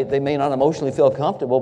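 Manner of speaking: ordinary talk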